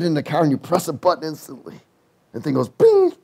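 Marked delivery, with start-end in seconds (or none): 2.7-3.1 chime imitation. Imitating the car seat chime.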